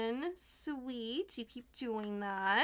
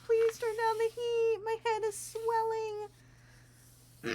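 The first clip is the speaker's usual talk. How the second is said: higher, louder hammed-up whine; mock overheated plea; 10 semitones higher, 4 dB louder than usual. Texting Tolkien to turn down the heat, hammed-up plea. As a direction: play it up like you're melting. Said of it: "I might snap Tolkien and be like".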